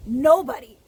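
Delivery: Nobody hit hard and loud; emphatic nobody. Real, not acted. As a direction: slam down on Nobody. Repeats nobody with force.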